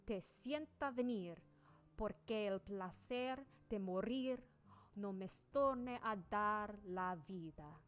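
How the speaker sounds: slow Spanish verse recital; Spanish recitation. Shown as a demonstration. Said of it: "That's Spanish from Miguel de Cervantes".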